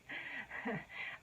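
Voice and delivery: soft breathy nervous giggle; a quiet, embarrassed little laugh. Real, not acted. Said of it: "that nervous laugh again".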